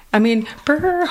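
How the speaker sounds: friends shivering mock. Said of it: "they're like".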